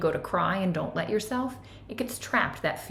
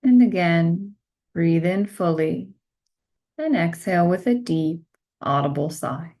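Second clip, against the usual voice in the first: guided meditation; 9 dB louder than usual. Acted. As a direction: guide them gently into stillness, one phrase at a time.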